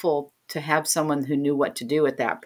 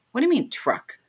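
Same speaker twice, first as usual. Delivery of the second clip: higher-pitched puzzled question; retold bafflement; 8 semitones higher than usual. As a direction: question the odd word in confusion. Retold baffled reply to mother.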